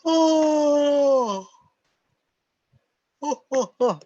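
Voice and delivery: big open yawn, high and loud. Shown as a demonstration. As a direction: yawn it out fully. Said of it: "I'm gonna yawn for you".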